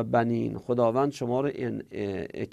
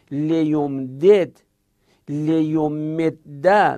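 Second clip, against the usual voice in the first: slow drill; 2 semitones higher, 6 dB louder, much slower than usual. Shown as a demonstration. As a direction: stretch each form out very slowly.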